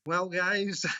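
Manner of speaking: Scotsman's put-on American accent; drawn-out mock American greeting; best American accent. Guest answers the hosts' challenge, put-on American accent. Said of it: "your best American accent".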